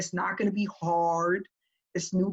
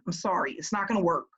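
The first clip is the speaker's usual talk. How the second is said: ordinary talk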